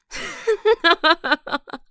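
loud high maniacal laugh; unhinged villain cackle; evil cackle. Acted. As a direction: gloat over the attackers. Villainous gloating.

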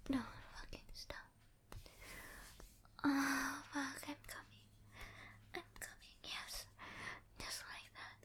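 urgent, breathy whisper close to the mic; breathless whisper. Acted. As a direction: whisper it, pleading and intimate. Intimate whispered roleplay.

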